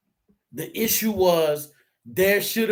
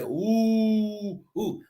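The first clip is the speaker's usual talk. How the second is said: class oohing. Retold or imitated. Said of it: "you hear the whole class like".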